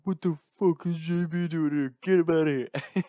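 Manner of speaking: put-on high, agitated drunken outburst; drunken Tex. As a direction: yell it like a drunk friend barging in. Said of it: "drunk Tex".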